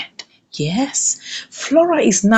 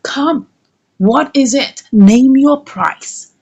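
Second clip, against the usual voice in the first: plain reading voice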